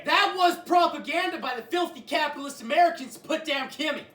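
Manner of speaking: high screaming chant; mock patriotic yelling. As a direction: scream it like a fanatic. Mock North Korean cheering chant.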